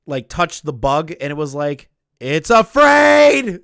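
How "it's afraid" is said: high, slow shouted exclamation; Starship Troopers quote. Retold or imitated. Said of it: "that scene in Starship Troopers when Neil Patrick Harris".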